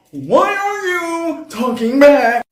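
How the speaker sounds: slow, drawn-out high yell; freaking out. Acted. Narrator yelling at her talking reflection, freaking out. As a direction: stretch the freaked-out question into a loud wail. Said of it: "Now I'm freaking the fuck out".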